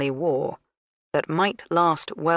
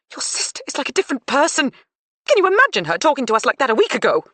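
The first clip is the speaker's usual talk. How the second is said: loud, fast indignant complaint; outraged, talking fast; 10 semitones higher, 6 dB louder, faster than usual. A narrator's reading. Mother indignant at daughter.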